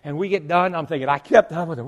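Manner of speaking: ordinary delivery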